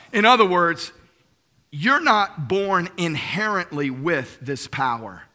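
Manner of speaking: normal talking voice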